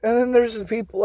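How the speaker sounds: usual speaking voice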